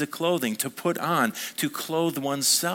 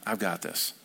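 low, quiet confidence; calm assurance; 8 semitones lower, 6 dB quieter than usual. Acted. Jesus to the worried believer, calm assurance. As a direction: drop it low and softer, fully assured. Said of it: "And Jesus is saying".

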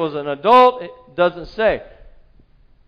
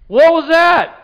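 surprised; 8 semitones higher, 6 dB louder, slower than usual. Acted. Crowd reacting to whisper.

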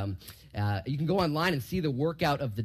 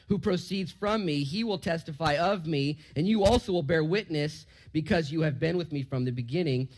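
scripture reading; 6 semitones higher, 3 dB louder than usual. Acted. Preacher reading the verse aloud to his class: raised, measured reading.

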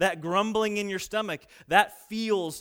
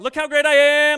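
loud, high, hammed-up braggart; a showy hypocrite crowing at the offering; mock bragging shout; 9 semitones higher, 14 dB louder than usual. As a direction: shout your own greatness to the crowd. Hypocrite shouting his boast.

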